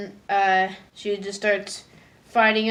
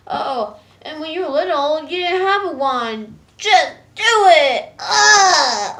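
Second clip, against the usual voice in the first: higher, louder cartoon pep talk rising to cry; puppy voice; 7 semitones higher, 9 dB louder, slower than usual. Lazy puppy urging Star in a dream, rising to a cry. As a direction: coax her then shout. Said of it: "lazy puppy talking to her".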